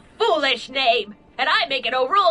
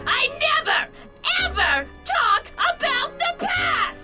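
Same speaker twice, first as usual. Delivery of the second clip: high crying shout; shrieking outburst; 12 semitones higher than usual. Acted. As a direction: break into a shrill wail. Edna's outburst about rules.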